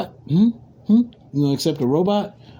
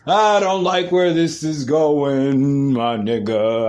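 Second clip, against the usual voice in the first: long, dragged-out lament; drawn-out dismay; slower than usual. Real, not acted. Podcaster dismayed at where the news is heading, drawn-out lament. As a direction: stretch the words out slowly in dismay.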